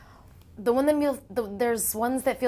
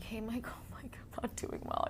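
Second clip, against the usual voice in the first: soft, weary whispered plea, a small laugh; exhausted new mother easing out of a session, whispering; tired whisper; 4 semitones higher, 11 dB quieter than usual. Retold or imitated. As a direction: whisper it like you're drained. Tired mother begging off quietly.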